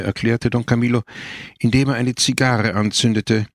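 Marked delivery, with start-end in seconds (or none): none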